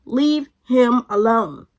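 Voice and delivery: slow, word-by-word insistence; emphatic order. Retold or imitated. Telling friend to drop the boy, slow and firm. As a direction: space out each word firmly.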